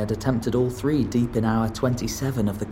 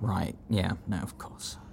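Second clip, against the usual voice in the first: whispering; 3 semitones lower, 10 dB quieter than usual. Real, not acted. Embarrassed aside.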